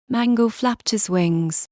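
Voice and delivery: plain reading voice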